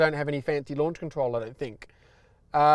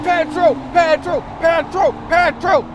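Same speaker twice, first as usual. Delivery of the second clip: high, loud victory chant; triumphant cheering; 11 semitones higher, 8 dB louder, slower than usual. Real, not acted. Winning the roll race.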